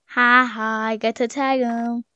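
ordinary talk, nothing marked

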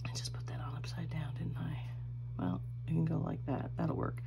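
sussurro rápido e sem voz; whispered aside. Real, not acted. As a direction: murmure para si mesma enquanto mexe em algo. Aside to camera while fixing something.

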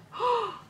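sudden high gasp of delight. Real, not acted. Unboxing a fan's letter, a high delighted gasp. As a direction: gasp sharply, thrilled by what you see.

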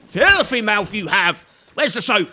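mock scolding. Scolds screaming enemy in game.

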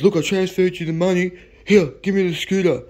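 high-pitched imitated demand; pushy insistence. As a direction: push impatiently like an eager buyer. Buyer demands scooter.

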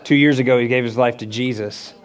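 normal talking voice

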